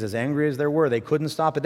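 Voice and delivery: normal talking voice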